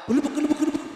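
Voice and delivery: rapid horse-gallop noise; galloping hooves. Acted.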